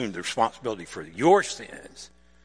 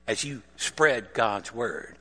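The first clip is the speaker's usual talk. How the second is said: usual speaking voice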